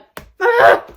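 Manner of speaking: loud high laugh. Real, not acted. Laughs at herself.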